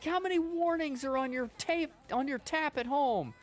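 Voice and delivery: high, loud ranting comic impression; Orny Adams rant. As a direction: yell it like a fed-up comic on stage. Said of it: "It's like".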